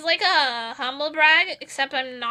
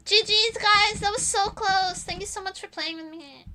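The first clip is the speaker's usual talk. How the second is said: put-on nice-teammate sign-off; sugary politeness; 6 semitones higher than usual. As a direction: switch to gracious, innocent thanks after the rage. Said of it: "and I immediately go".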